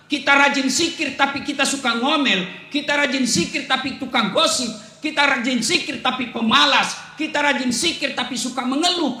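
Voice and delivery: ordinary delivery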